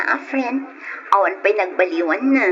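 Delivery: ordinary talk, nothing marked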